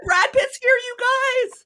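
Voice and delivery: very high, squealing Brad Pitt sighting; high-pitched starstruck gasp; excited fangirl squeal. Pretend. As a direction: shriek it like you just spotted a star. Mock celebrity sighting excitement.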